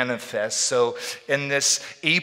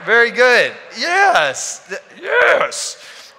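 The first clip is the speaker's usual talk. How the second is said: excited praise; 9 semitones higher, 7 dB louder, much slower than usual. Real, not acted. Praising the congregation's repeat.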